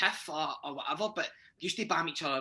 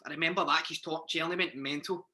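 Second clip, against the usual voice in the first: normal talking voice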